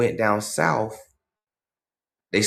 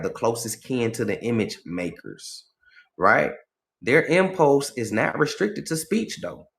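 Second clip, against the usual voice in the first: ordinary delivery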